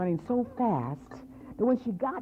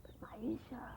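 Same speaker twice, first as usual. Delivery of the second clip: breathy hushed whisper; old woman whispering a warning to Maisha; 13 dB quieter than usual. Acted. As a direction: whisper her name like a secret. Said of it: "The old woman said".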